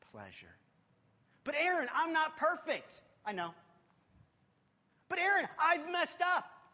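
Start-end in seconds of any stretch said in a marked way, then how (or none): 1.4-3.0 high, louder plea of a guilt-ridden believer; pleading doubter. Pretend. Imagined listener objecting to preacher. 5.1-6.7 high, louder plea of a guilt-ridden believer; pleading doubter. Pretend. Imagined listener objecting to preacher.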